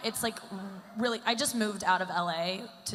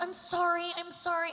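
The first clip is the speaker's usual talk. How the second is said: high, pleading mock sympathy; exaggeratedly sympathetic high voice; overly empathetic; 9 semitones higher than usual. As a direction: apologize with gushing sympathy, pitched way up. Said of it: "I'm always so empathetic".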